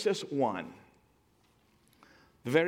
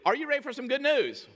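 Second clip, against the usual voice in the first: much higher, excited call to the crowd; rousing call; 11 semitones higher, 3 dB louder than usual. Shown as a demonstration. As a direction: rouse the congregation for an answer, pitched way up. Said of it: "you gotta really".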